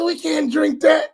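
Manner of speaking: high, pleading refusal; mock horrified refusal. Sheikh refusing the coffee, high and horrified. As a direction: refuse it like it might poison you. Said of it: "He said".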